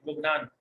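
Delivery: "लुबनान" opening on lu-, with a short u; short u after L. Shown as a demonstration. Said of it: "लाम को पेश है यहाँ". ("लुबनान" में लाम पर पेश की आवाज़)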